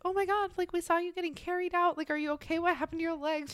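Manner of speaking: imitating friends, high and fussing; worried friends. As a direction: gush like an anxious friend. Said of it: "friends being like".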